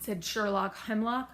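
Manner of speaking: plain reading voice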